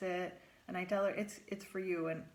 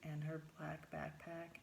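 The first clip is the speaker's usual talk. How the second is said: slow emphatic list; slower than usual. Real, not acted. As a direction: slowly list each item.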